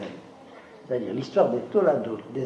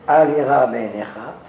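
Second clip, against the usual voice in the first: Hebrew verse reading; 9 dB louder than usual. Shown as a demonstration. Reads Hebrew verse aloud.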